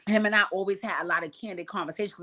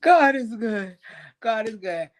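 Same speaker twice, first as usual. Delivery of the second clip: crying laughing; 4 semitones higher, slower than usual. Retold or imitated. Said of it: "I'm like".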